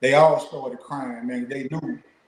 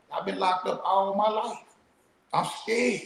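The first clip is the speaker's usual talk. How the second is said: imitating a trembling, higher crying voice; shaky tearful voice; 6 semitones higher, 3 dB quieter than usual. Retelling a scared prisoner about to go home, imitating his tearful shaking voice. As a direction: retell his fear with a quavering, higher pitch. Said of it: "His voice shaking and trembling".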